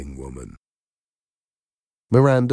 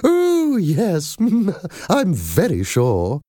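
put-on high, chuckling old nanny; laughing nanny voice; 12 semitones higher, 4 dB louder, slower than usual. A narrator's reading. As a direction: laugh it off sweetly. Madam Doubtfire voiced.

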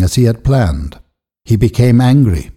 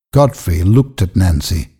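ordinary delivery

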